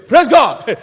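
loud mimicked praise shout; acted-out crowd response, loud; congregation's praise. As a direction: call it out like an eager congregation. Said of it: "they were saying".

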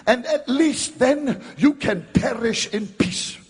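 high, loud, scornful mock with a laugh; mocking preaching. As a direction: ridicule the useless rescuer. Mocking an uncaring rescuer.

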